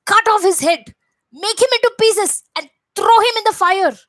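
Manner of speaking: furious king ordering the monkey killed; enraged order. Acted. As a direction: scream the sentence at his soldiers. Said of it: "This enraged Ravana".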